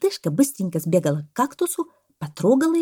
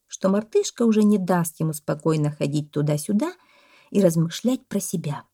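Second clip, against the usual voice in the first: ordinary delivery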